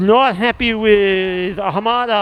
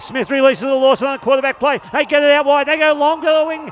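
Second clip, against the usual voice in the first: rising excited call; 5 semitones higher than usual. Real, not acted. Try scored, commentator calls it.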